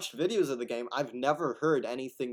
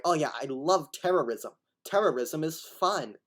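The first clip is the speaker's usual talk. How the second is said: mock gushing; 5 semitones higher, 6 dB louder than usual.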